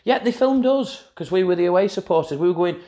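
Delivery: normal talking voice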